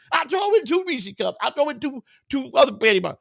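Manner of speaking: high-pitched imitation of a desperate haggler; begging. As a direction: act out an addict sweetening the deal. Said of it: "He begging me".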